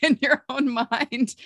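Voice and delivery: delighted laugh mid-sentence. Real, not acted. Laughing with delight at the guest's onion story.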